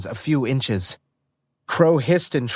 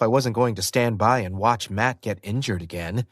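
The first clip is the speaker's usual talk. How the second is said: plain reading voice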